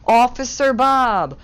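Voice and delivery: hammed-up cheery announcement; mock welcome. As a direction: drag out the officer's name with delight. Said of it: "the vice principal says".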